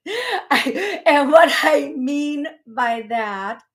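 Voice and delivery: laughing. Real, not acted. Host clarifying a remark that sounded bad, laughing. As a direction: explain yourself through a laugh. Laughs at her awkward phrasing.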